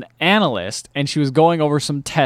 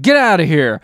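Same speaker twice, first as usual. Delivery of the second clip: retold yell; 4 semitones higher, 7 dB louder than usual. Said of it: "Nick Cage yells at the guy".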